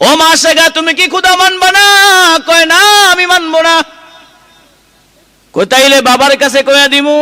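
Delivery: high-pitched, impassioned sermon delivery; high, projected waz storytelling; full-force preaching. Acted. Preacher acts out a heated exchange.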